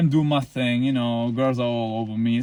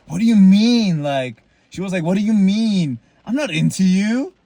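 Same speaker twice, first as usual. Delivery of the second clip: higher, louder mocking denial; 5 semitones higher, 6 dB louder than usual. Retelling the girl who denied liking him, higher and louder mock voice. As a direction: throw her denial back as a caricature. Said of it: "she gaslit me and said".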